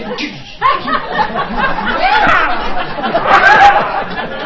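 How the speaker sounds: very high, loud, long laughter; uproarious laugh. Real, not acted. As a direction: burst into long squealing laughter. Laughing at his own story.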